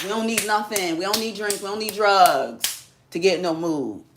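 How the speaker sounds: ordinary delivery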